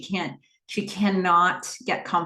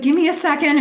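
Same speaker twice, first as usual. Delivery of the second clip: breathless panic; 9 semitones higher, 8 dB louder, faster than usual. Retold or imitated. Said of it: "she's hyperventilating".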